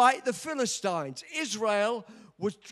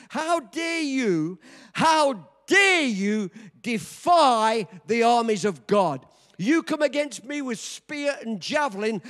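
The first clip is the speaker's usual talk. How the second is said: defiant challenge; 5 dB louder than usual. Acted. David defying the giant.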